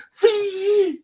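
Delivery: high, sustained zooming noise; imitated car zoom. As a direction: make a loud speeding-car whine. Imitates car speeding downtown.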